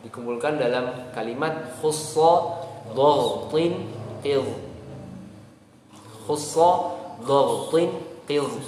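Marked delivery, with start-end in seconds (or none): 1.8-8.5 khussa dhaghtin qidh said very slow, each letter thick; thick letters slowly. Shown as a demonstration. Teacher recites the thick-letter mnemonic slowly. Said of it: "huruf-huruf ini dibaca dengan tebal".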